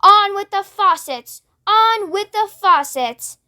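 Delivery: high call from above; shouted. A narrator's reading. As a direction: bellow down from the cloud. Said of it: "it shouted".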